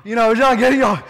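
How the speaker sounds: playful retold gasp; imitated gasp. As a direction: act out a startled gasp of delight. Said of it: "what at Ross caused you to go".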